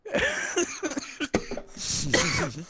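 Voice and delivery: long, high laughing fit; helpless laughter. Real, not acted. Winner after chicken dinner, high helpless laughter.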